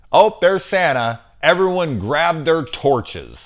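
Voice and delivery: high, loud mock villager call; mock townsfolk.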